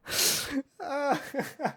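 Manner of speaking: high burst of laughter. Real, not acted. Host hearing the ableist reply, bursting out laughing. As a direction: crack up at the comment.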